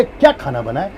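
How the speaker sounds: low, soft asking with a rise; honest curious question about dinner; genuine question. Shown as a demonstration. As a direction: ask it like you really want to know. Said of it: "इसको कहते हैं पूछा".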